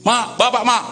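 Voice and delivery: calling out. Retold or imitated. Child calls out to mother.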